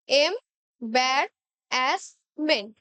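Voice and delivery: এম্বারাসমেন্ট very slow, syllable by syllable; split into syllables. Shown as a demonstration. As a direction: drag out এম্বারাসমেন্ট in syllables for the class. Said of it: "আমি আর একবার বলে দিচ্ছি".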